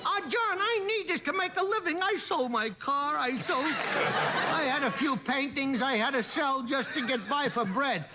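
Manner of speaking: high, slow lightly voiced painter pleading; Modigliani. Comedian does Modigliani begging John for bread, high and slow. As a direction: plead poorly like a starving artist.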